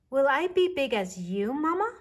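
put-on high, small child's voice; little bear voice. A narrator's reading. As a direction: voice a curious little cub.

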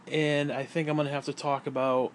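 normal talking voice

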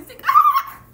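sudden high yelp; sour shock yelp. Real, not acted. Pixie stick shock.

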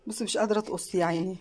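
usual speaking voice

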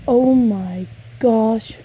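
loud, drawn-out amazement; amazed exclamation. Real, not acted. Collector seeing her doll's face, loud amazement. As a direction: stretch each word out loud and slow.

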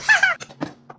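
squeaky laugh; high giggle. Real, not acted. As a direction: crack up again. Laughing at his bit.

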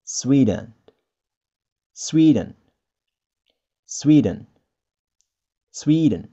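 Sweden said SWEE-dn, stress on SWEE; long ee then a short dn; teacher modelling Sweden in English. Shown as a demonstration. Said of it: "We may say".

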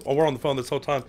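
ordinary talk, nothing marked

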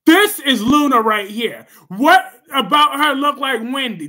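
emphatic. Real, not acted. Showing picture emphatically.

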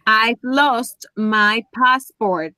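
very slow, carefully separated English words; drawn-out teacher demonstration; slow model sentence. Shown as a demonstration. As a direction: model it slowly after the student's attempt. Teacher repeats the corrected example slowly.

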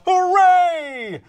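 high, loud hooray; big, drawn-out celebratory shout; shouted cheer. A narrator's reading. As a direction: cheer it out like a crowd. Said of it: "Pigs and neighbors all shout".

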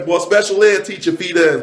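ordinary talk

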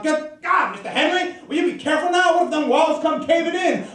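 high, anxious-worded yell of a captain; high-pitched acted yelling. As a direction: yelp a warning up at the hammer man.

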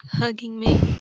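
normal talking voice

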